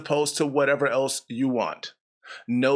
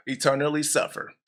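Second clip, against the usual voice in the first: ordinary talk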